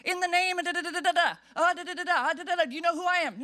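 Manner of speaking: fast, puffed-up self-important babble; pompous rambling. Retold or imitated. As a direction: rattle off empty titles. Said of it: "He doesn't need to say".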